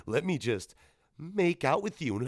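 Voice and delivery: slow, put-on flirty come-on; mocking lovestruck character voice; mock seductress. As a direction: play a hammed-up movie temptress, drawn out.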